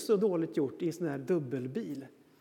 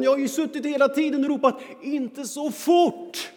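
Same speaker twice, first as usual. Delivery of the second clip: put-on higher, louder indignant child; upset kid; 9 semitones higher, 9 dB louder than usual. Son tells dad he yelled the warning, loud and upset. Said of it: "säger han".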